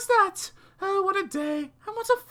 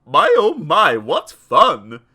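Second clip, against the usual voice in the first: loud, lower gloating yell; yelling with glee; 5 semitones lower, 11 dB louder, slower than usual. Acted. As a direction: revel in it aloud.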